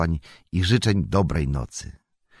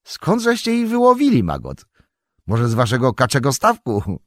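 much higher, loud, jokey banter; teasing Merry; 8 semitones higher, 6 dB louder than usual. Acted. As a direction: tease the farmer playfully. (Mary żartuje z farmera: wysoki, figlarny głos)

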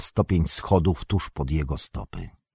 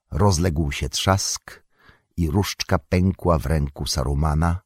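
plain reading voice

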